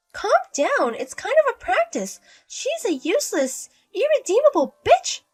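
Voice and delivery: sugary mother-in-law. Acted.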